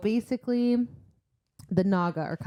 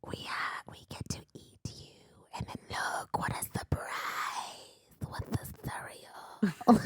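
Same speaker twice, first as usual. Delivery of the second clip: hissed creepy whisper, much softer; snake monster whisper; 4 semitones higher, 9 dB quieter than usual. Pretend. Host voicing the Naga taunting Feyre, hissing whisper. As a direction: breathe it like a hungry snake monster.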